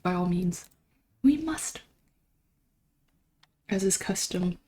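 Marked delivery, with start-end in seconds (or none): 1.2-1.8 "We must" jumped up high, playfully grand; mock solemn. Mock solemn echo of own words.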